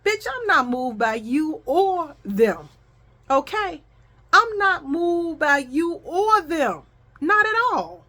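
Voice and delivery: slow, drawn-out defiance, pitch climbing; emphatic, unbothered clapback; defiant. Retold or imitated. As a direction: stretch each word to stand your ground. Defiant answer to the whispering woman.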